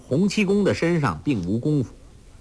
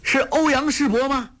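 much higher, strong carrying shout; young man hailing a distant swimmer; shout from the belly; 13 semitones higher than usual. A narrator's reading. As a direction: project it over the waves. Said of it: "气运丹田叫道".

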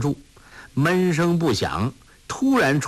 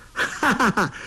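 big mocking child's laugh, higher; loud laughter; 10 semitones higher than usual. A narrator's reading. Dushi laughs at Tolui's threat, mocking laugh. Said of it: "都史哈哈大笑".

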